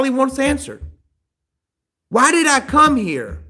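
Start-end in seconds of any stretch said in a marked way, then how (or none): 2.1-3.4 loud, high, bitter rant; angry bitter outburst. Acted. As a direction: pour out the grievance in anger.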